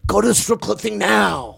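high-pitched retold self-command; desperate urging. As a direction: blurt it out high, mock desperate.

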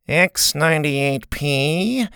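nasal, slow stern principal. Acted. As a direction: drawl the name nasally. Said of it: "in her nasally voice".

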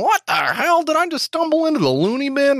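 high, shrill exasperated quote; exasperated mock rant. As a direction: act baffled and outraged. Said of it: "you're going".

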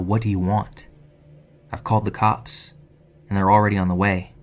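loud angry yell. Retold or imitated. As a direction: yell it out in a panic. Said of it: "in full exclamation, I yell".

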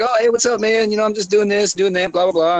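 high, chattering phone voice; mimicked chatty reply; breezy small talk.

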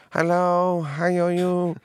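high, drawn-out mock Chinese accent. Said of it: "Full Chinese accent back?".

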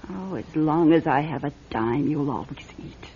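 normal talking voice

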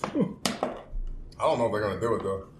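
talking around a sip of water; words blurred by a drink; while sipping a drink. Real, not acted. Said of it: "Sorry, I'm drinking my Fiji".

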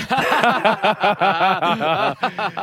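bursts out laughing loud at his own punchline; a loud, full laugh that breaks out; big real laugh. Real, not acted.

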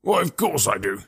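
wheezy, husky character voice; thick, wheezy policeman's voice; wheezing. A narrator's reading. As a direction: wheeze it out in blustering recognition. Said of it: "he wheezed".

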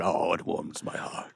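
slow, low and soft actor impression; John Rhys-Davies. Veteran actor thanking a fan, low and slow. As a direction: rumble it slowly, deeply touched. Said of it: "he's like".

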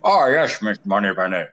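Scottish film-spy impression, dropping low; falling pitch, put-on Scottish voice; Sean Connery. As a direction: do a mock suave Scottish spy. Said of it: "Sean Connery in Red October".

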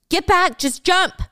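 high, loud panicky command; agitated shout. Retold or imitated. Panicked captor orders her away.

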